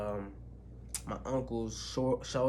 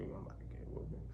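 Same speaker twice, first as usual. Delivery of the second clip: dread-filled whisper; 13 dB quieter, much faster than usual. Retold or imitated. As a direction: mutter it under your breath in fear. I'm like.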